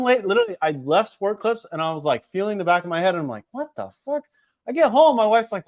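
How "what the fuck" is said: high, softer mock disbelief; retold dismay. Customer feeling the back of his head, retold dismay. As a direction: act out a hushed, high-pitched discovery.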